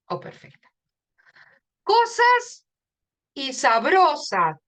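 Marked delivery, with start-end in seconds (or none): 1.8-2.7 Cosas called out much higher and louder on CO; emphatic demo word. Shown as a demonstration. Teacher calling out the rhyme word for the class, high and loud. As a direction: sing out Cosas to show its stressed O. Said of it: "Dónde está el fonema acentuado".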